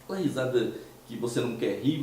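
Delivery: ordinary delivery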